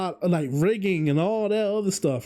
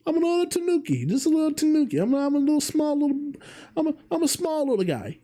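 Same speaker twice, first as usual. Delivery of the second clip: much higher, fast, cute critter voice; cutesy little tanuki voice; 10 semitones higher, faster than usual. Acted. Vtuber presenting his own model in a cute high voice. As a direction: squeak it out like a tiny tanuki mascot. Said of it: "I don't know why I'm talking in that accent".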